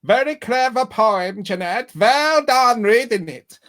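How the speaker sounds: loud, slow, high mock Transylvanian accent. Host re-reading a praising comment, hammed-up Transylvanian accent. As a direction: ham it up in a booming Transylvanian accent. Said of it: "She didn't do it in that accent".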